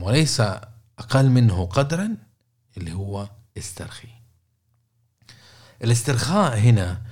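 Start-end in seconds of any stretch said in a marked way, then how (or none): 2.8-4.1 voiceless, softly letting the word out; hushed whisper of "استرخي". Acted. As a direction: breathe it like a calming cue. Introducing relaxation tip.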